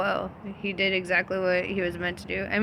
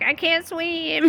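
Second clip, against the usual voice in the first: mock fear while laughing; 9 semitones higher, slower than usual. Peter scared to step out.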